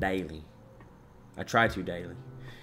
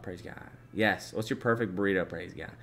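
usual speaking voice

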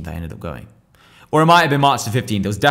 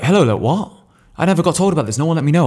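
higher, louder, flustered protest; retold panic; 7 semitones higher, 5 dB louder than usual. Told on the phone he missed court, panicking. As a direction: sound caught off guard and worried. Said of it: "I was like".

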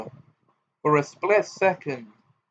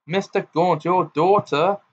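alarmed call, pitched up and louder; 5 semitones higher, 4 dB louder than usual. A narrator's reading. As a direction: cry out in alarm. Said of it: "said Ogden in alarm".

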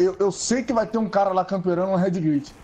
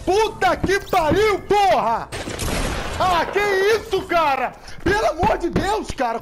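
screaming furious insults; raging gamer; 10 semitones higher, 4 dB louder, slower than usual. Real, not acted. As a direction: explode in gamer rage, shouting. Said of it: "ele é o cara dos rage".

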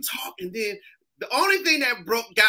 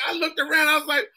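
usual speaking voice

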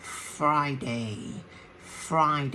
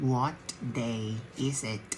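word by word; much slower than usual. Shown as a demonstration. Teacher splits question word by word.

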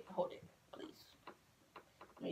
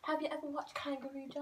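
higher, softer mock British; British accent; 4 semitones higher, 8 dB quieter than usual. Teasing friends during the accent dare, mock British accent. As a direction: ask in an amateur British accent. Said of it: "A British accent".

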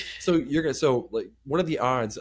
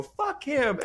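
a much higher, scornful jeer; contemptuous curse; 13 semitones higher than usual. Retold or imitated. Telling friends how he cursed the coach, high scornful jeer. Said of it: "And I go".

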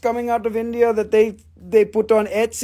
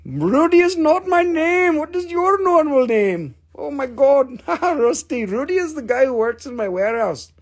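high comic character; 5 semitones higher than usual. Pretend. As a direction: exclaim it high, like a cartoon figure. Puts on a voice for viewers.

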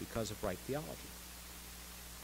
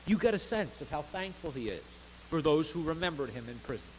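ordinary delivery

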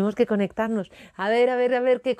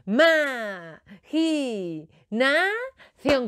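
"Imaginación" chanted very slowly, syllable by syllable; drawn out syllables; 3 semitones higher, much slower than usual. Shown as a demonstration. Storyteller rallying children to be heard far away, syllables drawn out. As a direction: stretch each syllable of "Imaginación" for a crowd to copy. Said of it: "Que te oigan en Sebastopol".

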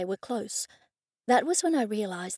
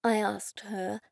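ordinary delivery, nothing marked

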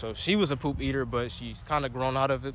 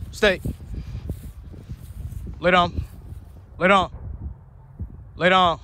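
raised, insistent command, said three times; firm command; 4 semitones higher than usual. Real, not acted. Owner telling her puppy to lay down, insistent raised command.

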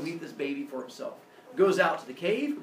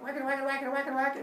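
rapid high-pitched patter of whacks; imitated whacking; 8 semitones higher, faster than usual. Baby whacking on the turtle shell, imitated noise. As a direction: rattle the word off like repeated hits. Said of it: "starts whacking on it".

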